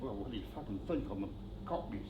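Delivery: talking quietly. Retold or imitated. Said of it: "I'm gonna talk very quietly".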